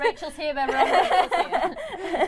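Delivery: high, loud, slow announcing with laughter; laughing mock announcer; mock megaphone announcement. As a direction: boom it out like a herald through a megaphone.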